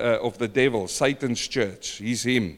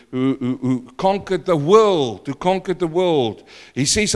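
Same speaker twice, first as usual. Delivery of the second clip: raised, driving pulpit voice; fervent preaching; 5 semitones higher than usual. Acted. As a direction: preach it out to the congregation. Preaching at full force.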